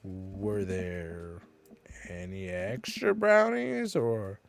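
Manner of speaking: fish for it, drawing out each phrase; wheedling, soft, drawn-out question; hinting request. Acted. Fishing for a brownie in game.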